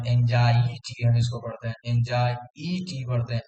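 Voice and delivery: एंजाइटी with the e held long, slowly; stretched vowel. Shown as a demonstration. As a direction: stretch the ई in एंजाइटी to mark the spelling. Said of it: "ई थोड़ा सा खींचते हैं". (एंजाइटी में ई को खींचकर)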